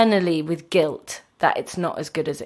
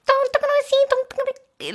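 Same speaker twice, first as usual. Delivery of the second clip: tiny, sing-song Chilean Don't; Chilean smallness. Retold or imitated. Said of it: "it's like".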